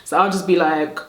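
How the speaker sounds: normal talking voice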